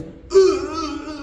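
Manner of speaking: high, drawn-out mock wail; shaitan's defeated groan. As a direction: wail like a beaten devil, hammed-up. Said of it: "Shaitan is".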